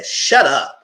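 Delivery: loud, irritated "Shut up"; loud fed-up retort; annoyed shout. Retold or imitated. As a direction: snap it out loud at him. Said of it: "I would say".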